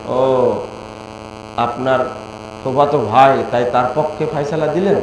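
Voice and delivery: ordinary delivery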